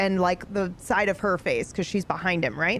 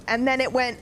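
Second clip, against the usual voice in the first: usual speaking voice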